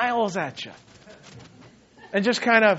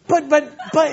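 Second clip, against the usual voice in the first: higher, stammered objection in imitation; mock flustered protest; 7 semitones higher, 4 dB louder than usual. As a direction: sputter like a worried young pastor. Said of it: "stressed and upset and can't believe it".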